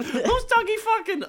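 squeaky high Mickey Mouse voice; shrill falsetto girlfriend impression; man doing a woman's voice. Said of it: "Do not ever do an impression of my girlfriend".

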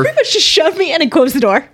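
loud, high, laughing exclamation; laughing disbelief. Real, not acted. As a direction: blurt it out through laughter. Player laughs at being shoved inside.

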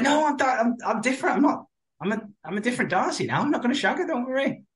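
high-pitched, hurried mock reassurance; reassuring protest. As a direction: voice an over-eager promise to behave. Acted quote of reassuring Darcy.

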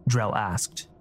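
ordinary delivery

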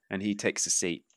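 usual speaking voice

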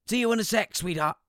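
high, bright fatherly phone voice; doting dad answering the phone; cheery dad. Retold or imitated.

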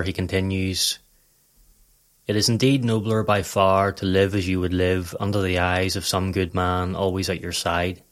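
usual speaking voice

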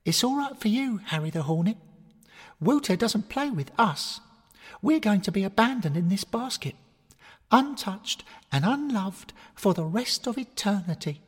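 a tiny bear wailing her fate; whiny girl bear. Acted. Maisie Bear's lament.

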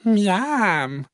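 high, drawn-out yum; delighted exclamation. Acted. Little fox tasting the small bowl, delighted cry. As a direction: cry out with relish. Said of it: "s'écrie-t-elle".

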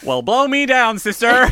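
loud, high-pitched mock American accent. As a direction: burst out with a hammed-up American accent. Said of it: "I bet he's doing his American accent".

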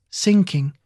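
plain reading voice

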